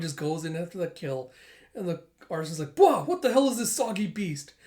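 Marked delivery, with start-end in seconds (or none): 2.8-4.5 high, loud, startled outburst; acted alarm. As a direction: exclaim like a villain caught off guard. Arsonist startled by attacking dog.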